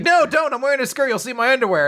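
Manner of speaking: very high, loud flustered protest; comic alarm at top pitch; mock panic. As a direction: frantically stop someone, hammed-up.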